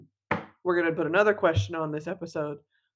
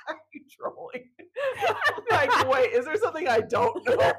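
higher-pitched question through real laughter; laughing; 13 semitones higher than usual. Real, not acted. Co-host asking if there's something they don't know, laughing. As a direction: ask it while cracking up. Cracking up, asks if co-host trolls.

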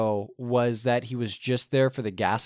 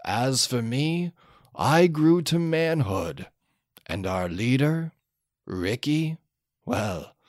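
mock epic narration; 4 semitones lower than usual. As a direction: narrate like the film's old narrator looking back. Parody Road Warrior closing narration.

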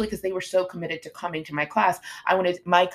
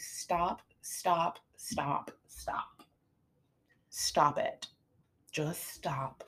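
each stop softer until a whisper; fading stop; 8 dB quieter, much slower than usual. Real, not acted. Trainer hushing clients' extreme plans, fading to a whisper. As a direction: let the stops die away to a whisper.

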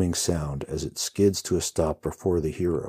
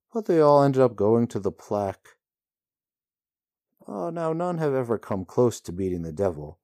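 higher, louder, offhand rider voice; breezy rider voice; 7 semitones higher, 4 dB louder than usual. A narrator's reading. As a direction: shrug off horror lightly. Rider's voice in story.

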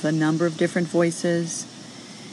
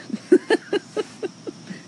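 amused laugh. Real, not acted. Laughs at idea of writing book.

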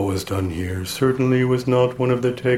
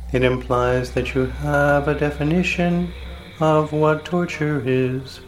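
ordinary talk, nothing marked

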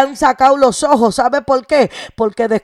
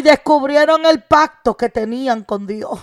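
ordinary talk

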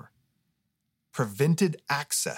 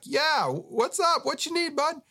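high, slow, casual holler; hollering back; slower than usual. Retold or imitated. Jesus calling back across the road, casual holler. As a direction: holler back, laid-back and far. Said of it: "hollered back across the road".

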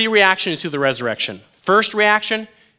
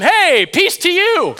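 high, loud, drawn-out hello; hammed-up greeting; 5 dB louder, slower than usual. Preacher playing Jesus suddenly appearing, comically cheery. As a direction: pop up with a comic, slow greeting.

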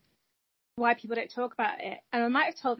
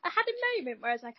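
ordinary talk

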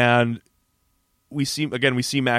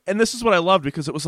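normal talking voice

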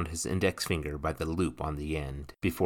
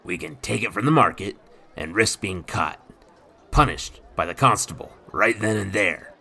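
lightly voiced gruff pirate, a touch louder; rough pirate voice; 6 dB louder than usual. A narrator's reading. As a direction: speak like a swaggering old sea rogue. Nathan voiced.

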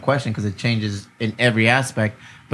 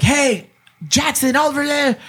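put-on high, drawn-out call to a child; slow mock scolding, pitched way up; raised parent voice; 13 semitones higher, slower than usual. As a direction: imitate a dad calling his kid to order. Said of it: "you raise your voice a little bit".

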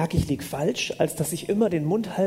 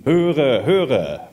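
loud, lowered, mock-solemn voice of God; Charlton Heston as God; 3 semitones lower, 5 dB louder than usual. Interpreter copies the preacher's parody of God, loud mock-grand voice. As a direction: proclaim it like an epic-film God. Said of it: "Wir denken immer, Gott redet wie Charlton Heston".

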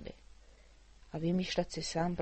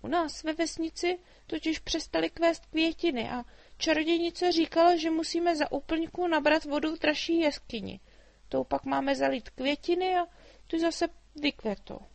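put-on much higher, lively girl's voice; a chatty girl teasing the dragon; little girl Mája; 9 semitones higher, 7 dB louder than usual. A narrator's reading. Girl explains her quest. (vysoký živý dívčí hlas)

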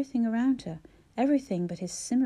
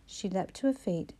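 ordinary delivery, nothing marked

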